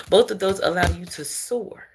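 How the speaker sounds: normal talking voice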